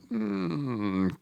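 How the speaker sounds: ordinary talk, nothing marked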